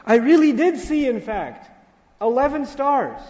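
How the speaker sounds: put-on a young boy begging his dad to believe him; a child insisting to his father, high and urgent; insistent child. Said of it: "And so he says".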